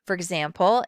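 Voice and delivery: ordinary talk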